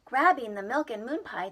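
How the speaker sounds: ordinary delivery, nothing marked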